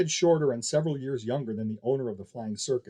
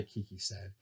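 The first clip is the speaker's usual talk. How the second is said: plain reading voice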